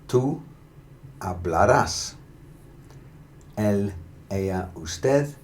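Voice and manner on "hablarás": hablarás with emphasis on rás; hablarás, rising onto a strong rás; final-syllable stress. Shown as a demonstration. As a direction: punch the last syllable of hablarás. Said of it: "particular emphasis on the last syllable".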